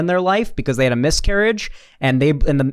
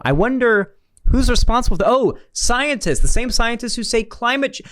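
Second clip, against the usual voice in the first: high-pitched feigned puzzlement, then a sudden realisation; mock wondering; 9 semitones higher than usual. Commentator mocking conservatives over who made ultrasounds better, sarcastic wondering. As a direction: pretend to puzzle it out, pitched well up.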